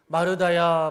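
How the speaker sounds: loud calling. Acted. Jesus calls Martha.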